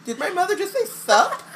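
slow, whimpering mock distress; Ginny aghast. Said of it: "Ginny's like".

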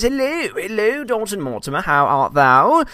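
high, slow olde-English voice; mock old-fashioned. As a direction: ham up the thou. Archaic chat message voiced.

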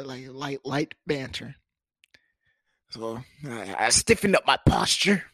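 ordinary talk, nothing marked

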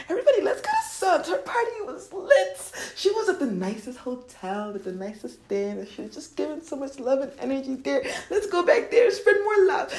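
high, giggly party hype; rally the friends, bubbling over; excited gushing. Acted. Money rallies its friends, gushing.

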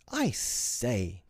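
put-on drawn-out boyish exclamation; excited cry. A narrator's reading. John spotting something in the nursery, excited. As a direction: call out the discovery. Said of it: "cried John".

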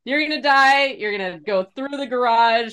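put-on high, pleading protest of a kid; imitating panicked kid. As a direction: mimic a child predicting doom. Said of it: "He was like".

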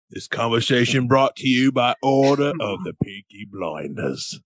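hammy British sponsor announcement; theatrical put-on Peaky Blinders British; British accent. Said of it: "in a British accent".

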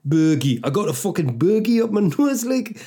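high-pitched comic Geordie voice; cartoonish Geordie lilt; Geordie accent. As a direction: put on a broad Geordie whine. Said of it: "Bergie does sound like bogey in a Geordie accent".

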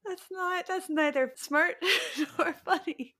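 high, laughing disbelief. Real, not acted. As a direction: laugh out the verdict. Incredulous laughing.

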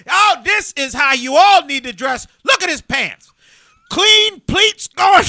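loud and high, last phrase stretched slowly; principal gushing. Retold or imitated. Principal praising the boy's pleated pants to the crowd, loud and high. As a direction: show off his pants to everyone.

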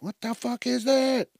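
high baffled question; confused pet voice. Retold or imitated. Pet wondering what the beeping is, high. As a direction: voice the puzzled pet.

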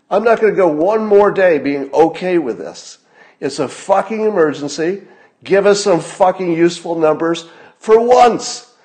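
real fury at incompetent officials; furious rant. Real, not acted. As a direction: yell at the officials in real rage. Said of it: "I'm getting really mad".

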